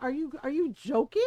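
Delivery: loud, disbelieving demand; mock outraged question; loud disbelief; incredulous anger. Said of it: "she got mad".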